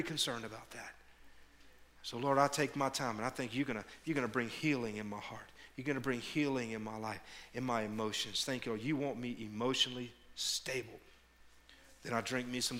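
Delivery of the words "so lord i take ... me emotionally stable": quiet prayer. Acted. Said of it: "reenacted prayer".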